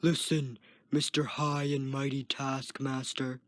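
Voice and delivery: understated sarcasm; sarcastic growl. A narrator's reading. Guard mocks the Taskmaster, mildly sarcastic growl. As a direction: needle him with the mock title. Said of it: "the guard sarcastically growled".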